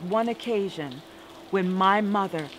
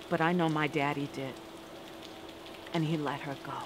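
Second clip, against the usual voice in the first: hushed; 5 semitones lower, 9 dB quieter, faster than usual. A narrator's reading. Quiet aftermath of the memory.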